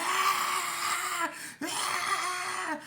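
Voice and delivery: long, high, wailing screams; retold scream. Storyteller reenacts getting kneed in the face, wailing screams. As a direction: reenact a panicked wail, loud and very high. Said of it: "I'm screaming".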